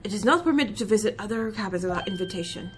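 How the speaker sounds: put-on a proud Russian crewman, heavy accent; Russian seaman introducing himself, low and gruff; Stroganoff, Russian accent.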